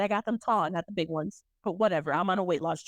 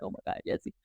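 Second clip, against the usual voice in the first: whispered stepdad; 7 dB quieter, much faster than usual. Retold or imitated. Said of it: "He was like".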